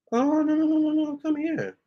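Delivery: high pet-calling voice; high coaxing to cat. Real, not acted. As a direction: sweet-talk the cat over. Coaxing her cat to come back.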